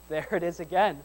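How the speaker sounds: normal talking voice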